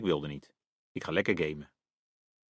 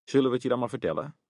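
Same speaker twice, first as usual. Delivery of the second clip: teasing; 5 semitones higher, faster than usual. A narrator's reading. Parents tease before telling.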